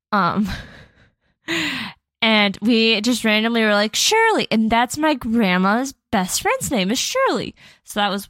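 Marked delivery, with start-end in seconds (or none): 4.0-4.5 much higher, sudden exclamation; retold blurt. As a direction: blurt the name out in a high voice. Blurting out a car's name, retold.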